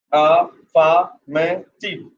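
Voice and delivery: very slow, split into four syllables. Shown as a demonstration. Teacher showing students the four syllables, syllable by syllable. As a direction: break AFFIRMATIVE into four separate syllables, a-fa-ma-tiv. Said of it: "four syllable here".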